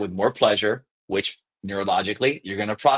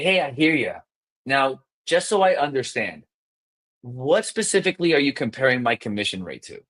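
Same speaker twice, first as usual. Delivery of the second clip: measured, curious sales cadence; slowed-down empathetic. Shown as a demonstration. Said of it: "So you'll say".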